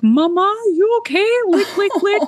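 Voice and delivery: high-pitched doting dog voice; cutesy high voice for a big sweet dog; voiced sweet dog. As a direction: pipe up like a concerned, adoring dog. Voicing huge sweet dog to owner.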